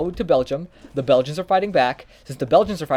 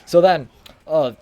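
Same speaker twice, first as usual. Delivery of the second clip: ordinary delivery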